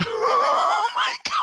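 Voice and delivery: real high, loud outburst of laughter; laughing shriek. Real, not acted. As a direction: shriek it through helpless laughter. Table bursts out at the roll.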